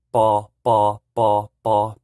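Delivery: "ba" loud and clipped, repeated; teacher showing Hmong P. Shown as a demonstration. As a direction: drill "ba" four times. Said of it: "the normal Hmong P".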